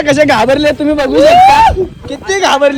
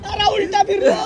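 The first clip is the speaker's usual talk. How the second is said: higher yell, stress on Ooltah; startled yell; 6 semitones higher, 9 dB quieter than usual. Real, not acted. Riding upside down, yelling at friends in shock. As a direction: shout as you go upside down.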